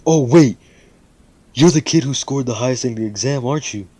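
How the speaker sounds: high, loud burst of realisation; sudden recognition. Retold or imitated. Teacher recognising the top exam scorer, startled. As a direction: blurt it out as it dawns on you.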